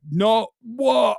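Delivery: high startled protest; imagined outrage in the cinema; mock shocked outburst. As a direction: play-act being appalled. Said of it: "I'll be like".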